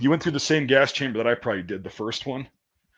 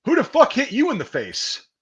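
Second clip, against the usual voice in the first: much higher, barked question; drill sergeant demand; 11 semitones higher, 4 dB louder than usual. Retold or imitated. Drill sergeant confronting the bloodied recruit, barked demand. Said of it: "he goes".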